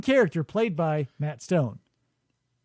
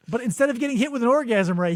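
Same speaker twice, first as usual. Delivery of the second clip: ordinary delivery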